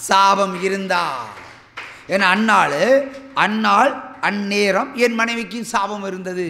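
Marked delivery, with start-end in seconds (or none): none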